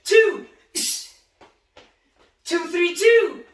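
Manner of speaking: loud, high punch call-outs; shouted counts. Shown as a demonstration. Coach calling punches to boxers, loud counts. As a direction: bark each count out to the class. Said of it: "ready, and let's go".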